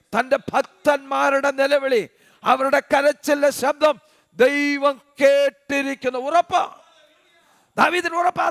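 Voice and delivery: loud, high, driving sermon delivery; fiery preaching. Acted. Preacher stirring the morning congregation, loud and fervent.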